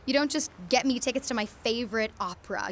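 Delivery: normal talking voice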